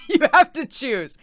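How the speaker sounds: mock insistence. Sam insists on one name.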